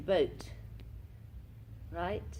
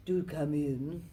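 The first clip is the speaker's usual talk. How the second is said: Richard Ryan impression; slower than usual. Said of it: "Richard Ryan, who's".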